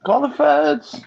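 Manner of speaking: high squeaky mock call; mocking the caller with a squeaky high voice; mock shriek. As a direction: squeal it in mockery.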